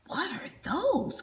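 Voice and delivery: unvoiced, hushed asking; whispered question. Crag whispering while peeking through bushes. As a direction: whisper so they don't hear.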